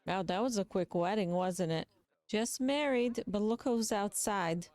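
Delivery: usual speaking voice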